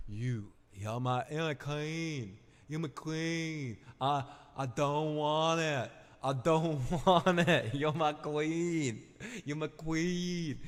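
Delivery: slow, earnest kneeling vow; devoted pledge. Acted. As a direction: plead it slowly with devotion. Daemon kneels to Rhaenyra.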